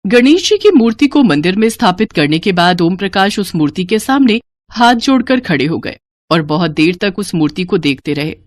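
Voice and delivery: ordinary delivery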